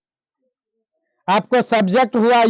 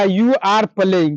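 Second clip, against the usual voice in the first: very slow, each word set apart; slow teacher's model sentence; slow model answer; 3 semitones lower, much slower than usual. Shown as a demonstration. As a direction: dictate the English answer to the class. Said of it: "तो आप क्या कहिएगा?".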